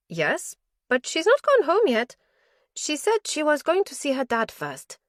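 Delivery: a lightly voiced hospital nurse, eager to help; nurse's voice. Nurse at the station greets the visiting detective, lightly voiced. Nurse answers about Sue.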